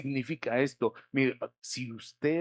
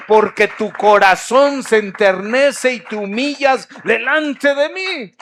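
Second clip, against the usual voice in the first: loud, high climactic preaching; preaching at full force; 7 semitones higher, 15 dB louder than usual. Acted. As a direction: drive the promise home at the top of the voice.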